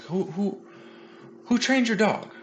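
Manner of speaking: high, curious questioning voice; imitating the trainer. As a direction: ask it with bright imitated curiosity. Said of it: "and he's like".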